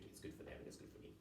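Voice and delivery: fast, whispered half-joke to himself; whispered aside. Real, not acted. Lecturer to students, a quick whispered aside. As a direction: let it slip out under the breath. Wry aside after promoting course.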